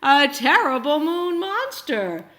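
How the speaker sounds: mock scared, building to the monster; hint of a shudder in the voice. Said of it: "he shuddered".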